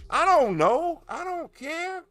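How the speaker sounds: carefree high squeal. Acted. Carefree exclamation.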